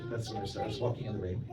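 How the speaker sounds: usual speaking voice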